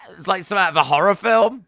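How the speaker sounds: fast and loud, tumbling out; breathless excitement. Real, not acted. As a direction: gasp it out still worked up. Still excited after the scream.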